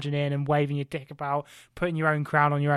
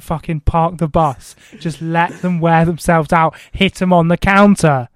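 building to a shout; 10 dB louder than usual. Retold or imitated. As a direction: hammer the tactic home, growing louder each line. [name] repeats advice, rising force.

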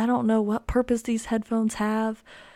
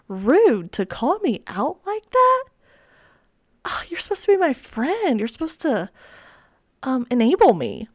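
hammed-up betrayal at a friend; mock offended; 4 semitones higher, 4 dB louder than usual. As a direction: whine it high, playfully wounded. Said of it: "Just kidding".

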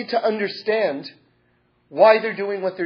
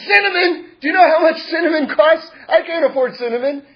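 much higher, louder shocked shout; outraged husband; 9 semitones higher, 6 dB louder than usual. Acted. Poor husband yells at his wife about the cost of cinnamon, outraged. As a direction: shout it as if robbed.

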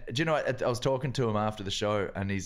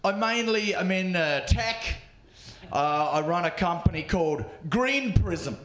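higher, pompous rich tech boss voice; posh rich tech boss; 7 semitones higher than usual. Acted. Comedian opening his set, playing a smug rich tech boss. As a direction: brag like a smug millionaire. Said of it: "the way I chose to start my set".

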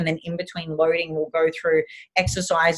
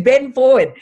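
loud, higher barked order to bend; retold command; 7 semitones higher, 7 dB louder than usual. Physio ordering her patient to bend, loud command. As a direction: order him down like a strict physio.

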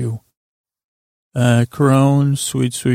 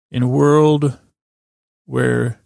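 movie trailer voice; slower than usual. Acted. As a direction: start like an epic film trailer. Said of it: "That was called the movie trailer".

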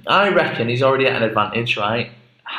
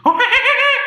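squealing laugh, much higher; high burst of laughter; 7 dB louder than usual. Real, not acted. Laughing at his own story.

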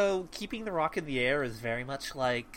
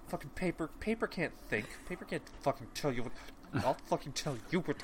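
quick muttered rant, softer; angry fast grumble; 7 dB quieter, faster than usual. Retold or imitated. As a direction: grumble it fast under your breath. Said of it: "Belafon is fucking pissed".

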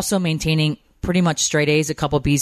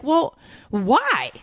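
imitated puzzled husband, pitched high; baffled husband; 11 semitones higher than usual. Husband asking crying wife why, imitated as high and puzzled. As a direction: mimic a bewildered spouse, voice up high. Voices husband questioning her tears.

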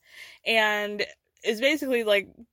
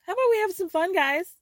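higher, bubbly party invitation; mother calling the group to party, in a higher, eager voice; mock party excitement; 10 semitones higher than usual. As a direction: pitch it up like an eager hostess ready for fun. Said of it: "Linda goes".

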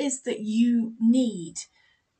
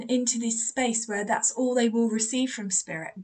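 normal talking voice

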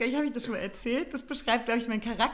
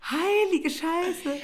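higher, very slow dawning shock; stunned realization; 9 semitones higher, much slower than usual. Retold or imitated. Realizing she nearly gave her passwords away, stunned. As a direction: let the penny drop slowly.

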